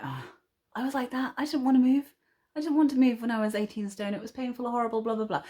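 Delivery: mock her old reluctant self; imitating her old self. Vlogger mimicking her old self refusing exercise, mild whine. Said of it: "we're like".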